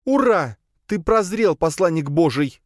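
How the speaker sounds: high, mock-jubilant cheer; sarcastic exclamation. A narrator's reading. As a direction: cheer mockingly in a high woman's voice. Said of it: "воскликнула Надя". (язвительное высокое восклицание)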